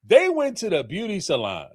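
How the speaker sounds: ordinary talk, nothing marked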